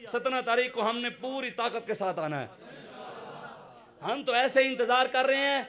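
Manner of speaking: high, fervent sermon voice; impassioned preaching. Real, not acted. Preacher urging the crowd toward the march, impassioned preaching.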